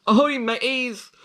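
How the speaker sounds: hearty pirate call, high and loud. Pretend. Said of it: "pirate voice".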